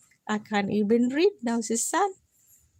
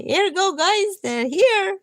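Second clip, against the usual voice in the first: calling out news; 6 semitones higher, 4 dB louder than usual. Acted. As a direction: call it out to the guys. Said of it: "I will say".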